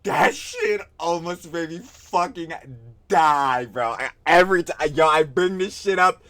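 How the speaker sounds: loud, high, breaking up with laughter; laughing hard. Real, not acted. Streamer cracking up at a pic, loud laughing. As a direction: barely get it out through laughs.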